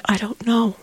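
soft, high, hushed "I don't know"; quietly retelling her bewildered answer. Retold or imitated. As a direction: let it fall almost to a breath.

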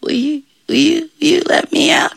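high, halting little voice; sheepish begging. Acted. Devil stuck in bush asks John for release, sheepish. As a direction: falter as you beg politely.

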